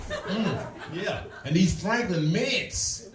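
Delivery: high-pitched savoring mimicry; delighted party guests. Guests praise the peanuts at a party, high savoring voices. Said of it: "People like".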